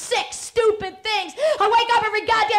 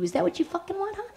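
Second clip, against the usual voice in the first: ordinary talk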